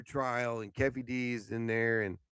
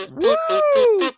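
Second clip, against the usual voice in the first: whooping cheer, much higher; high loud woo; 11 dB louder than usual. Real, not acted. Cheering to wake up.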